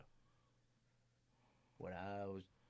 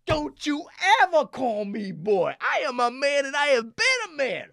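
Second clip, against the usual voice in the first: furious warning; 13 semitones higher, 16 dB louder than usual. Retold or imitated. [name] angrily warning the teller never to call him boy, loud furious. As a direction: lay it down hard. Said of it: "I go".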